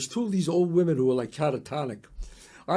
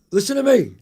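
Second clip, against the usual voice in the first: emphatic demand; 4 dB louder than usual. Retold or imitated. Demanding the best vacuum.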